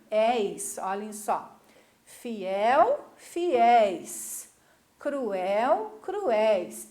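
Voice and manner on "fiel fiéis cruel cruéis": "Fiel, fiéis", "Cruel, cruéis" with the final syllable loud and stretched; stress on last syllable. Shown as a demonstration. Teacher showing oxytone plurals, "Fiel, fiéis", "Cruel, cruéis" stressed on the last syllable. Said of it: "a última sílaba é a mais forte".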